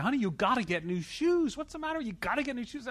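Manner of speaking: nagging wife. Retold or imitated. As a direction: play the wife nagging him to buy shoes. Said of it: "my wife is yelling at me".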